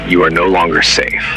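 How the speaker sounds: ordinary delivery, nothing marked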